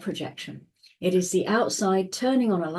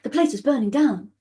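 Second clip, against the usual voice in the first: higher, louder panicked warning; alarmed shout; 6 semitones higher, 5 dB louder, faster than usual. Retold or imitated. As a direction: burst in raising the alarm. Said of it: "someone comes racing in saying".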